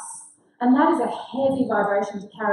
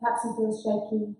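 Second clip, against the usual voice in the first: guided meditation; 8 dB quieter than usual. Acted. Facilitator guiding the group with closed eyes, soft meditation voice. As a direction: guide them into calm, softly.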